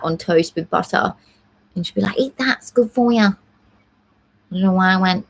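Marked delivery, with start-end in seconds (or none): 2.2-3.3 a light Manchester accent imitating mum; mum's voice slipping into a mild Manchester accent; coaxing a sick kid to eat, in a hint of Manchester accent. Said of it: "like a Manchester".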